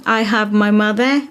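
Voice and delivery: ordinary delivery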